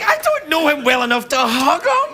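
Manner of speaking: high, giggling self-mockery; laughing inner thought. Recalling hugging Tim Robbins, laughing at himself. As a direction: laugh through the embarrassed thought. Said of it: "And I'm like".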